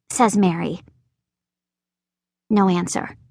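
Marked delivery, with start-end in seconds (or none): none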